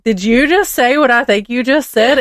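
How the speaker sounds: a mom who can't believe her ears; shocked mom. Retold or imitated.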